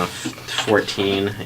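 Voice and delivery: ordinary talk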